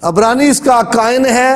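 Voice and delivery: normal talking voice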